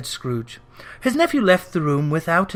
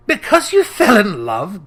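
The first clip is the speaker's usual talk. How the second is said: louder scornful growl echoing the nephew; a louder sneering growl; 4 semitones higher, 6 dB louder than usual. A narrator's reading. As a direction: spit the words back with contempt. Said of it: "growled Scrooge".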